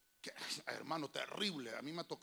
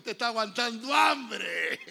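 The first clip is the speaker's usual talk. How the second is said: louder, slow, drawn-out retort; slow loud punchline; 4 semitones higher, 12 dB louder, slower than usual. Retold or imitated. Grandson telling grandmother she's just going hungry, slow loud punchline. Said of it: "le digo".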